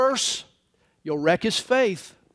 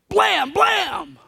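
shouted gunshots; 9 semitones higher than usual. Acted. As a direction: bark out two shotgun blasts. Imitated double gunshot.

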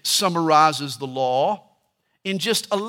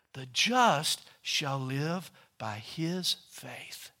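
slow, quiet, reverent verse reading; preacher lowers his voice to read the key verse; solemn scripture read; 6 semitones lower, 12 dB quieter, much slower than usual. Acted. Preacher to congregation, slow reverent recitation.

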